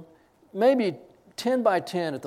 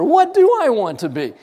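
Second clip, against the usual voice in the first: raised, louder retelling of his question; loud amused question to the interviewer; excited loud question; 7 semitones higher, 10 dB louder than usual. Retold or imitated. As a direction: ask it louder, higher, amused. Asked her, laughing.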